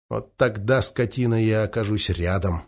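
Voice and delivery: quiet, low, under the breath; a silent menacing vow, barely voiced; soundless promise. A narrator's reading. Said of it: "беззвучно пообещал Нучик".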